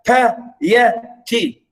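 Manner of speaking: "piety" very slowly split into pi, e, ty; syllable by syllable. Shown as a demonstration. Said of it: "stress, unstress, unstress".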